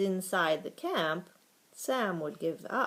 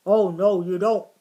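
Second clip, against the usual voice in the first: very loud dog's growl; 12 dB louder than usual. A narrator's reading. Watchdog stopping the mouse from hiding, loud growl. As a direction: growl it forcefully as a warning. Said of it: "growled Sam".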